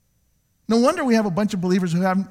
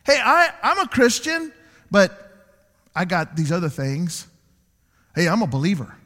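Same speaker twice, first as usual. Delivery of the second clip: mock hypocrite; 7 semitones higher, 5 dB louder than usual. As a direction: ham up the two-faced churchgoer. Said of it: "The person says".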